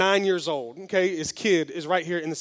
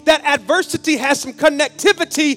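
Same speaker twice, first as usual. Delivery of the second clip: loud, high, driving preacher voice; full-force preaching; 7 semitones higher, 7 dB louder than usual. Acted. Pastor pressing the lesson on the church, full-force preaching. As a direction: bring it home to the congregation.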